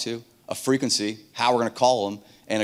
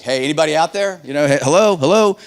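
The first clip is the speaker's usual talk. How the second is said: loud, higher radio hail; raised voice, hellos pitched up and loud; calling out; 5 semitones higher, 11 dB louder than usual. Retold or imitated. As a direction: call out for anyone listening.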